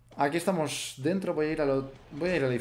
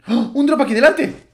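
excited surprise; 9 semitones higher, 10 dB louder than usual. Real, not acted. Spots a supply drop.

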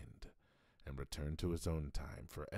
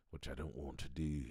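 ordinary talk

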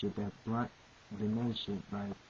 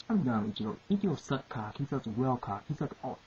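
ordinary delivery

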